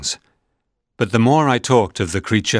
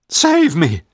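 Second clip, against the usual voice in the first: much higher, loud desperate plea; panicked scream; 8 semitones higher, 4 dB louder than usual. A narrator's reading. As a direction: scream it louder as terror peaks. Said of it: "I shouted".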